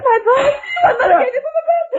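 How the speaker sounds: high, tearful, shouted dismay; wailing over her broken gift; distraught outcry. Acted. Ghost smashes her treasured things.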